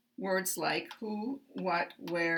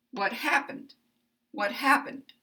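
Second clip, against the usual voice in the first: high, stressed "What happened" with a step down at the end; falling question intonation; 4 semitones higher than usual. Shown as a demonstration. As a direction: go up on the first syllable of "happened", then step down. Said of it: "go up for this syllable".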